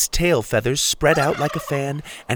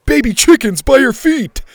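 much higher, loud prank shout; goat shouting; 9 dB louder than usual. Acted. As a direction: yell a fake warning. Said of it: "Gabe the goat shouted".

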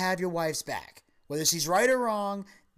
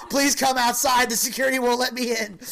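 higher and louder, hammed-up lover; mock romantic plea; 7 semitones higher, 6 dB louder than usual. Lover texting a girl from airport security, hammed-up plea. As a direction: plead dramatically at the airport door.

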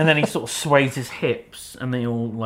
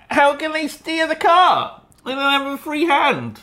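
mock outrage; 11 semitones higher than usual.

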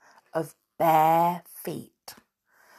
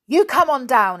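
loud, raised-pitch angry order; 7 semitones higher, 8 dB louder than usual. A narrator's reading. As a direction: order an intruder down crossly. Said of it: "he said angrily".

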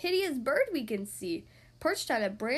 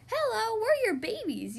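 much higher, sweet; little babies' voice; 8 semitones higher than usual. Acted. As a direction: speak as tiny babies. Babies greet parents.

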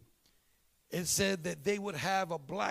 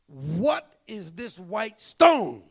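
loud prayer; 10 dB louder, much slower than usual. Retold or imitated. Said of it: "I'm saying".

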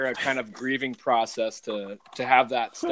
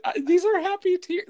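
much higher, put-on voice; imitating her reply; 4 dB louder than usual. Retelling the wife's answer to the kids, in a high mock voice. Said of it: "She's like".

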